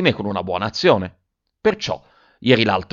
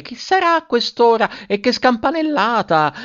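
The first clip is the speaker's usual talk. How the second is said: put-on much higher chirpy girls' voices; chirping girls; 13 semitones higher than usual. A narrator's reading. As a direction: chirp it like excited sisters. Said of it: "si mettono a cinguettare".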